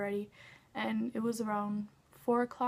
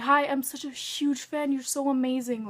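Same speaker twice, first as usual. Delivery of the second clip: louder, higher gushing to her idol; breathless fan greeting, pitched up and loud; excited fangirl; 4 semitones higher, 6 dB louder than usual. Retold or imitated. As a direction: gush like you finally met your hero.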